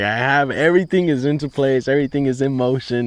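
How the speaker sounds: bubbling over that it's all working; excited. Retold or imitated. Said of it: "super excited".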